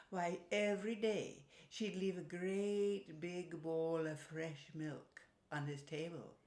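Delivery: plain reading voice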